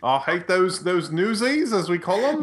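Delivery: teasing mock Australian accent. Shown as a demonstration. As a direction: grumble about rivals in a jokey Australian accent. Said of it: "I'll stop doing that".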